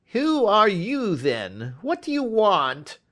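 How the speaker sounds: frightened little man challenging a stranger; quavering voice. A narrator's reading. Little man startled by a touch on the shoulder, quavering. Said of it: "asked in a quavering voice".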